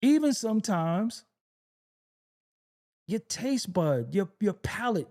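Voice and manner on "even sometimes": ordinary talk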